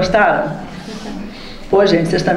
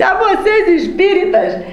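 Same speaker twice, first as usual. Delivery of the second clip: high, laughing mockery of the spirit; mocking spirit; 6 semitones higher than usual. Spirit at the séance mocking spiritists, high and laughing. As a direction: scoff at the spiritists through a laugh. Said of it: "Ele disse".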